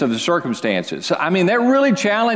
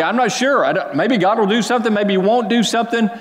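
higher and fast, voicing a doubting believer; anxious uncertainty; 7 semitones higher, faster than usual. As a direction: rush it, unsure and worried. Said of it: "Or fear-based, it's filled with all this emotion".

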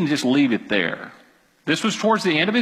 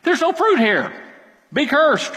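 a little angry; 12 semitones higher than usual. Retold or imitated. Jesus, a bit ticked off, curses the fruitless tree. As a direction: curse the tree in mild annoyance. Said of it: "maybe he was just mad, a little angry".

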